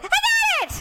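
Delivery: very high exasperated film-character yell; Jenny. Retold or imitated. Bride in the film insisting she's managing, shrieking high.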